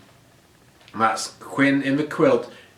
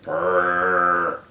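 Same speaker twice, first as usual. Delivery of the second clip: low shivery brrr; shivering brrr; 5 semitones lower than usual. Acted. The cat shivers from the cold, a low brrr. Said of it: "And Miss Kitty said".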